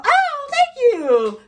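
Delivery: high, slow, gushing customer-service chirp; hammed-up cheerful phone greeting; mock excited phone voice. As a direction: gush it like a peppy call-center rep. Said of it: "y'all want this excited".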